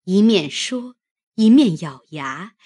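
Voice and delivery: ordinary delivery, nothing marked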